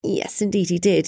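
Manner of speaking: ordinary delivery, nothing marked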